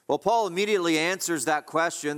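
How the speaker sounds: usual speaking voice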